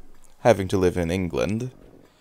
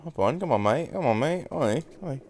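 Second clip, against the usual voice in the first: put-on light Aussie accent calling out, playful; half-hammed Aussie hollering at a mate; lightly put-on Aussie accent; 4 semitones higher than usual. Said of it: "I can also do an Aussie".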